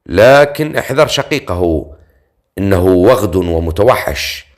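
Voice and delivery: ordinary delivery, nothing marked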